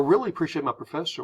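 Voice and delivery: fast, run-together American phrasing; quick and casual; speeding through. Shown as a demonstration. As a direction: rattle it off in one go. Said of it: "speeding through the phrase".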